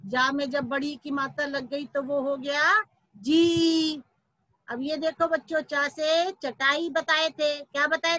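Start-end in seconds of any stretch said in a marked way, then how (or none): 3.2-4.0 जी with a long, held ee; long vowel. Shown as a demonstration. Teacher demonstrating how जा with matra is pronounced, long-held जी. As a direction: draw out the vowel of जी. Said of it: "उसका उच्चारण कहेंगे".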